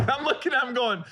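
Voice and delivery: ordinary talk, nothing marked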